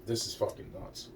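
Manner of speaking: hushed unvoiced whisper to himself; muttered aside in a whisper. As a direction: breathe it out as a whisper, disbelieving. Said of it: "I'm saying to myself".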